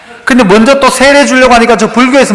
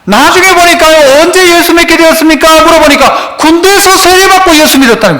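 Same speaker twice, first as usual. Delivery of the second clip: raised, fervent sermon voice; preaching; 7 semitones higher than usual. Acted. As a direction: preach it out to the congregation. Preaching at full force.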